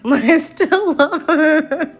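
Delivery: high, slow, laughing repeat; laughing through it. Real, not acted. Admitting love for her old character while laughing helplessly. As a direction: say it again while cracking up with embarrassment.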